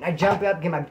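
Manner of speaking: ordinary delivery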